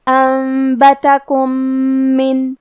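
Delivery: انبتکم من with the two meems merged into one long nasal meem; merged meem with ghunna. Shown as a demonstration. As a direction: hum one doubled meem across انبتکم من. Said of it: "ایک ہی میم تشدید اور غنہ کے ساتھ".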